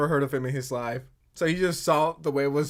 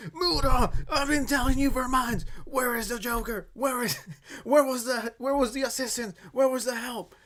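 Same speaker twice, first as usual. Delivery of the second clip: imitating her, pitched higher and pleading; mock breakdown; 6 semitones higher than usual. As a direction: lightly mimic a frantic woman losing it. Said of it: "why is Mama Max having a mental breakdown?".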